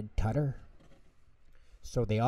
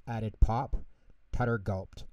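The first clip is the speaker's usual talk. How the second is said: usual speaking voice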